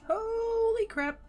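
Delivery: slow, high, drawn-out amazement; slow stunned holy crap; awed surprise. Real, not acted. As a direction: stretch it out in high disbelief. Stunned by the view.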